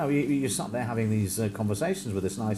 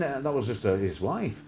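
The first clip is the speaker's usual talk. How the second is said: ordinary delivery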